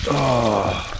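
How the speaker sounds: normal talking voice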